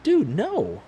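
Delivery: sudden high protest; husband refusing the cousin's story, high and emphatic; shocked denial. Retold or imitated. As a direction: yelp the no up high.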